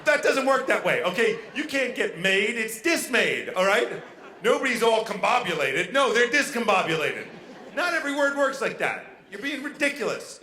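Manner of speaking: loud, mock-indignant correction; exasperated arguing. Boyfriend arguing with girlfriend about chalant, exasperated. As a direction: argue the word with comic exasperation. Acted argument over a made-up word.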